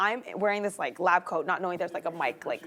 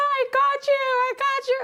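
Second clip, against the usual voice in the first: gleeful gotcha; 5 dB louder than usual. Retold or imitated. Friend jumps out shouting gotcha.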